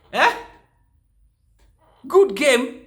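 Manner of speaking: high, loud disbelieving echo; incredulous. Real, not acted. Vlogger mocking the coach's claim, incredulous. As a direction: throw the words back in disbelief.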